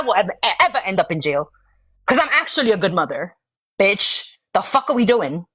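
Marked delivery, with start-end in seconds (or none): none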